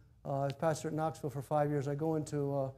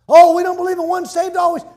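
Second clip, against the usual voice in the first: much higher, louder whiny objection; mock objector; 19 dB louder than usual. Mocking church members who object to his point. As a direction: whine it like a know-it-all.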